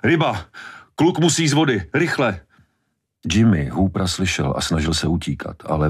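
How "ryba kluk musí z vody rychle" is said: high-pitched alarmed calls; urgent warning. A narrator's reading. Hooper warning that the boy must leave the water, urgent high calls. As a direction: call out in short, high, urgent bursts.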